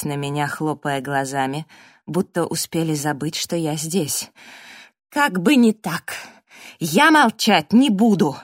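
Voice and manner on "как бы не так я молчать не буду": loud, slow, emphatic refusal; raised, indignant voice; defiant shout. A narrator's reading. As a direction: refuse to be silenced. Narrator shouts defiance at silenced group.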